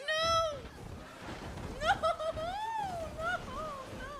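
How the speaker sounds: high, loud desperate screams; repeated panicked screams; screaming no. Real, not acted. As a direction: scream as you fall. Falling off a cliff.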